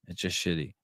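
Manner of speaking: grumbled, deflated aside; muttered repeat. Shown as a demonstration. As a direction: drop it under your breath. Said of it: "Shit, it's just shitty".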